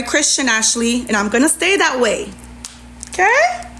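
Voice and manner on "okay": high, pointed challenging okay; high sassy okay. Real, not acted. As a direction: fling it up high as a dare.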